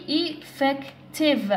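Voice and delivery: "effective" slowly, as e, fec, tive; split into syllables. Shown as a demonstration. Teacher recapping three syllables, "effective" said beat by beat. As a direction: break "effective" into three spaced beats. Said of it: "عندها three syllables".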